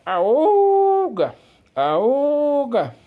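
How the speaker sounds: loud, high honking car horn imitation; old-car klaxon hooted with the voice, long held note; imitated klaxon horn. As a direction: hoot loudly like a vintage motor horn. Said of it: "buzina claxon".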